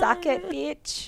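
high gleeful taunt; gloating taunt. Real, not acted. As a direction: rub it in. Gloating at reviewer.

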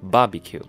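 barbecue with the stress on BAR; barbecue, accented on its opening syllable; stress on first syllable. Shown as a demonstration. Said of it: "重音是在第一个".

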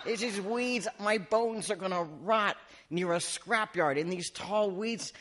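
high-pitched, hammed-up wailing; mock lament. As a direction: bemoan your own death with comic self-pity. Imagines his own murder, laments.

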